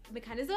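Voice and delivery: "mechanism" lifted at the end like a question; question intonation. Shown as a demonstration. Catching their own uptalk for listeners, questioning lilt on "mechanism". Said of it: "everything sounds like a question".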